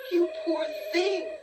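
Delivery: high, tender cry of pity. Acted. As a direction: pour your heart out to the poor creature. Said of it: "cried the mother".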